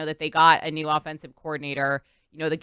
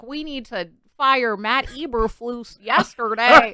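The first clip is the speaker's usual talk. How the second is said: slow, higher-pitched Chicago dad; bad Chicago accent; 6 semitones higher, 3 dB louder, slower than usual. Host imitating a Chicago dad on sports radio, bad Chicago accent. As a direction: do a hammed-up, drawn-out Chicago accent. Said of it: "just, like, a Chicago dad".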